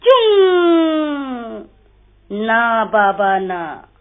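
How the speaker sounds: slow, drawn-out refusal; airplane refusing. A narrator's reading. Airplane refuses the goat, slow and drawn out. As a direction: stretch the why and the no. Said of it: "हवाई जहाज बोला".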